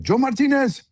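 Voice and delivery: high mock holler of a name; calling out. Friend hails him from the dark hotel, imitated high call. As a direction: imitate someone calling across a dark lobby. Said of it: "this shadow calling my name".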